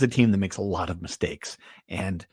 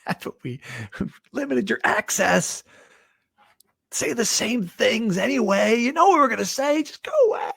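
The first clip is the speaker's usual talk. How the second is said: mock coach brushing off press; 11 semitones higher than usual. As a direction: play a fed-up coach shooing reporters, hammed up. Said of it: "That'd be the exact press conference".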